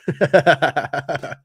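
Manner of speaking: a loud, high burst of laughter. Real, not acted. Host cracking up at a quote he reads aloud, loud laugh.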